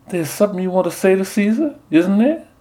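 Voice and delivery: high sugary belle voice; sweet southern belle. Retold or imitated. Nun as Portia sweet-talks the Soothsayer in a belle voice. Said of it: "silky, sweet voice".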